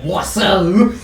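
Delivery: loud, drawn-out mock French accent. Game master tries a player's French name, hammed-up French accent. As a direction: ham up the name "Boisseau" in French. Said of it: "That's my best French accent".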